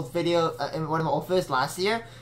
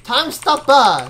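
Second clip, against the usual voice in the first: slow, high, loud battle cry; shouted attack call; 7 semitones higher, 8 dB louder, slower than usual. Acted. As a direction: belt out the move name.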